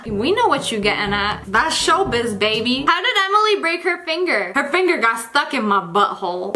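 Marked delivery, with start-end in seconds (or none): none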